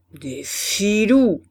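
slow, loud growl of contempt; growled grumble. A narrator's reading. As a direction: growl it out, dragging the words. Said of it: "grogna Levaque". (grognement lent et appuyé)

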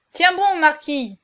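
high, loud, drawn-out cheering; cried encouragement. A narrator's reading. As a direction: cry out to a flagging champion. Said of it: "s'écrièrent les joueurs".

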